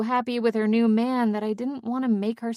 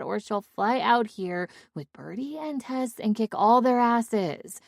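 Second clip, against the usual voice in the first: plain reading voice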